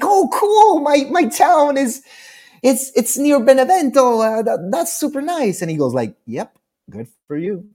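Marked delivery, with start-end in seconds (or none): 0.0-5.6 very high, excited small talk; imitating your own over-keen, high-pitched gushing; gushing enthusiasm. Retells gushing at the dry rival. 6.3-7.6 softer, dismissive brush-off; imitating an uninterested rival's quiet reply; dry. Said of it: "so dry".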